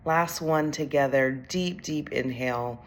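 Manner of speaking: calm, unhurried meditation voice with long pauses; guided meditation. Acted. Executive coach leads overwhelmed leaders through breathing, calm guided meditation. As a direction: guide them gently through each breath.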